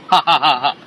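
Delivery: low mocking villain laugh; unhinged villain laugh. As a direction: cackle at them like a gloating villain. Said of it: "এগুলো বলছে কে? ইহুদি যুবক।".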